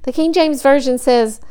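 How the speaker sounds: ordinary talk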